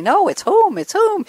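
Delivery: whom stressed and pitched very high; insisting on whom. Retold or imitated. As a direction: hit whom hard and high both times. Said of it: "we kept saying".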